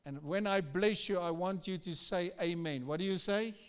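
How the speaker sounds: ordinary delivery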